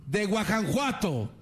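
high, slow pompous orator; high slow mock proclamation; mock Hidalgo speech. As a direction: proclaim like a hammed-up hero. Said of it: "se pone a gritar".

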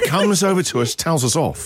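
usual speaking voice

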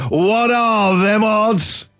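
slow drawn-out announcer call. Acted. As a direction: boom it out like a jingle. Segment jingle catchphrase.

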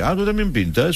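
imitating a patronizing snob; condescending mock surprise. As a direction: act the snob who finds your painting cute. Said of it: "como diciendo".

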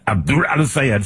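mock Arabic accent on the name; self-correct into a hammy Arabic accent; showy Arabic-accented name; exaggerated Arabic pronunciation. Shown as a demonstration. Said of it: "almost forgot to do it right".

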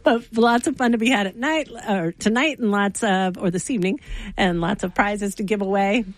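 usual speaking voice

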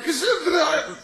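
put-on extremely high drunken squeak; squeaky drunk joining the round; little voice from the back. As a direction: slur it high and tiny from the back. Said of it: "una vocecita".

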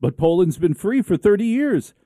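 high-pitched mock disbelief; incredulous drama character. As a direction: protest it like a melodrama actor. Acted drama character protests disbelief.

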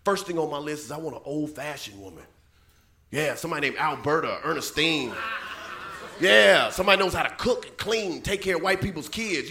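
quiet low mom lecture. Retold or imitated. Comedian quotes mom's advice in a quiet low voice. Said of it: "she's like".

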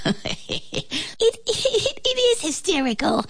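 high-pitched, breaking up with giggles; laughing fly. A narrator's reading. Fly unable to stop over the joke, laughing. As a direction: stutter it out through laughter. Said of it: "The fly began to laugh".

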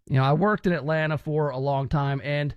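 usual speaking voice

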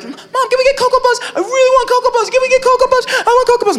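high-pitched, pleading kid brother imitation; begging child. Brother begging mom for Cocoa Puffs, high whiny pleading. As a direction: pester mom in a very high little-boy voice.

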